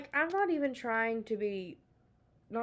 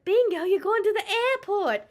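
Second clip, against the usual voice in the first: excited shout; 11 semitones higher, 10 dB louder than usual. Real, not acted. Teasing exclamation.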